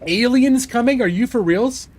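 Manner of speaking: high, loud excited gasp of disbelief. Real, not acted. Streamer hears of the Alien chapter, thrilled surprise. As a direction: shoot up in thrilled surprise at the news. Excited reaction to game news.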